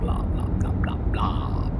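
bored whisper, words tossed away; whispered blah. Acted. Skimming the files to himself, bored whisper. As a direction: whisper it like you stopped reading.

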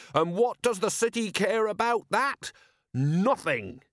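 high-pitched soapbox questioning; indignant harangue. A narrator's reading. Merchant stirs up the square, indignantly. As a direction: throw the question to the crowd, high and aggrieved.

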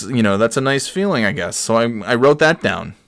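ordinary delivery

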